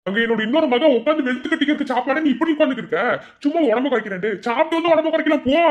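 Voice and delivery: loud, high-pitched scolding uncle. Acted. Uncle loudly scolding his niece to go eat. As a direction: bark at her to go eat.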